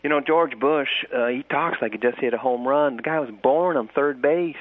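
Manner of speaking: put-on doing a broad Texas accent, ribbing Bush. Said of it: "in his best Texas accent".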